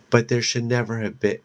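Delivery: ordinary delivery, nothing marked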